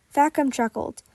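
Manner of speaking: ordinary delivery, nothing marked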